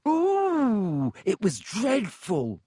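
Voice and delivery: very high, slow savoring ghost voice; with relish. A narrator's reading. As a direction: relish the gory memory. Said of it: "she said with relish".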